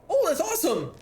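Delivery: high-pitched burst of delight; delighted exclamation. Real, not acted. Streamer cheering a viewer's good news, delighted. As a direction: jump up high with happy surprise.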